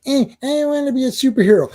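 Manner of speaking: high, fast mocking voice of a reluctant hero; mock-quoting a character who won't be a hero. Mocking Jessica Jones's reluctance.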